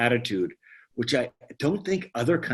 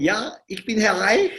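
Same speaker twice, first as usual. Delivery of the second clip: high, put-on German "Ja, ich bin Herr Reich"; playful German accent; German reply; 8 semitones higher than usual. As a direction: answer in a jokey Swiss German voice. Answering the call in German.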